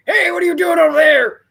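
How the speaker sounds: high, strained mock shout across the yard; old man Craig. Old man calling out to his neighbor, in a mock shout. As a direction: imitate a cranky neighbor hollering over. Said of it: "Here's old man Craig going like".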